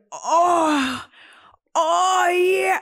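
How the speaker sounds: loud high mock moaning; moaning man. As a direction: ham up a man moaning in pleasure. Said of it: "there's this one guy going".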